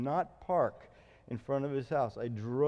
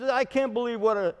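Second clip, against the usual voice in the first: 1 mock yell; 7 semitones higher, 6 dB louder than usual. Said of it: "He's yelling at me".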